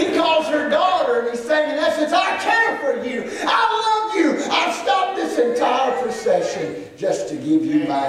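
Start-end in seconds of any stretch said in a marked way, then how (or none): 2.1-8.1 full-force preaching. Acted.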